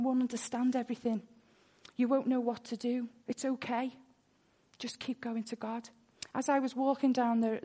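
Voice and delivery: ordinary talk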